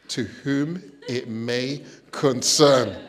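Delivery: very slow, breathy posh formality; posh dictation. Retold or imitated. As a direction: dictate it like a snooty letter.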